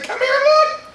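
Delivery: very high, squealed insistence; hammed-up excited kid begging; frantic urging. Boy urges his doubting parents, frantically excited.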